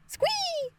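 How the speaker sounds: high-pitched fangirl squee; squeal. Retold or imitated. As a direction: squeal with delight. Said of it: "I was like".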